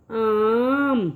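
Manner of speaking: "آم" with the آ held very long; long drawn آ sound like a teacher. Shown as a demonstration. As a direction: stretch the آ in "آم" slowly. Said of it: "اس کی آواز تھوڑی کھچ کے آتی ہے".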